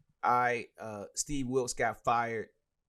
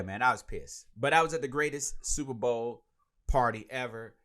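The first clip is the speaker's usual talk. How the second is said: usual speaking voice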